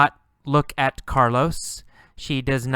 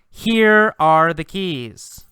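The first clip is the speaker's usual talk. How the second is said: slow, louder presentation of the keys; slow handover; 4 semitones higher, 6 dB louder, slower than usual. Acted. Carlos slowly handing the girl his keys. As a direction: hand them over with ceremony. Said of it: "And he says".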